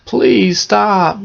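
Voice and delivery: high, hammed-up tearful begging; mock-crying child's plea. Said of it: "And Gretel cried and cried".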